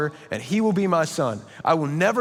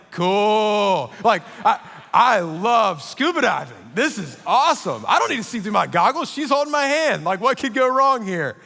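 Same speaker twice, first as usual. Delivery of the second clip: put-on louder, higher, giddy teen thrill; giddy excitement; 4 semitones higher, 3 dB louder than usual. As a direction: gush like a smitten boy, loud and bright. Teen thrilled holding crush's hand.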